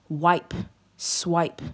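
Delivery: usual speaking voice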